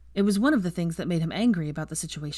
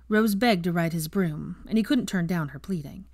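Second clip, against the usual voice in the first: ordinary delivery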